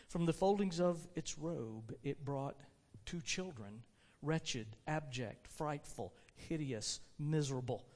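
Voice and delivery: low, soft, measured reading; grave story narration. A narrator's reading. Preacher reads of two wretched children, grave narration. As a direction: read it gravely, pausing between the words. Reads grim passage aloud.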